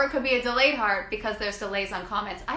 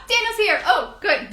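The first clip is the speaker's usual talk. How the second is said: gasp, then a high, loud burst of relief; light up as the guest finally arrives; excited gasp; 11 semitones higher, 6 dB louder than usual. Real, not acted. Guest finally joins the live.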